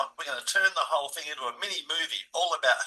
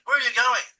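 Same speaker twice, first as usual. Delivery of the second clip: high, loud call after someone leaving; 9 semitones higher, 8 dB louder than usual. Retold or imitated. Ex-wife calling after him as he walks out, high and loud.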